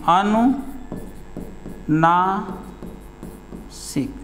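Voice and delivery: अनुनासिक stretched very slowly and softly, the nasal sound held long; drawn-out nasal demo. Shown as a demonstration. Teacher showing pupils the nasal sound, very slow and soft. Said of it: "मुख और नाक दोनों से".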